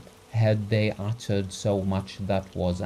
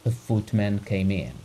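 ordinary delivery, nothing marked

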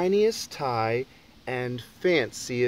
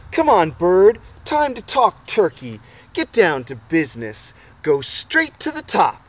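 excited urging; 3 semitones higher, 6 dB louder than usual. Acted. Boy urges bird to business.

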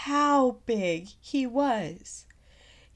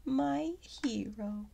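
whispered, softer; admiring whisper; 12 dB quieter than usual. Acted. Little one whispers admiringly as Gerald heads off. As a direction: breathe it in awe.

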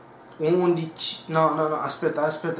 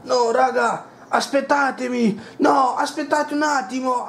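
urgent high call; 9 semitones higher, 5 dB louder than usual. Acted. Bathroom gamer calls to team.

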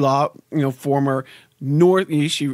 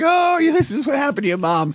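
much higher, imitating a Korean-accented mother-in-law; Korean accent impression; 11 semitones higher than usual. Son-in-law imitating his Korean-accented mother-in-law retelling a story. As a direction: put on her Korean English for this line. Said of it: "that's how her mother talks".

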